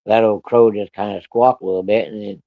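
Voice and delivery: ordinary talk, nothing marked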